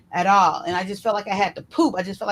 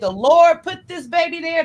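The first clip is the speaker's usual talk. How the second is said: higher and louder, insisting on faith; firm conviction; 7 semitones higher, 6 dB louder than usual. Retold or imitated. Telling the doctor God decides, higher and louder. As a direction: stand your ground.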